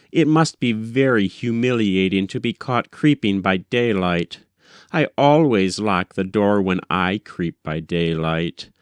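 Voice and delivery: usual speaking voice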